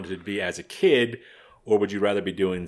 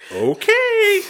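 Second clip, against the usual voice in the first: mock okay; 8 dB louder than usual. Clerk giving in to odd request.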